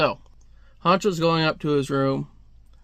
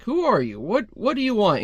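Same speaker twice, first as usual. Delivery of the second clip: alarmed questioning; 6 semitones higher than usual. Acted. Startled man questions an intruder.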